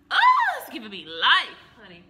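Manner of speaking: loud, high gush of delight; extra. Real, not acted. Admiring herself on camera, loud and gushing. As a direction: rave about herself, way over the top. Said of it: "too much. I'm extra".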